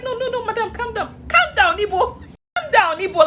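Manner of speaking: high, pleading quote; urgent placating. Retold or imitated. Staff member to angry mother, high urgent placating. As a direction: imitate someone frantically calming a person down.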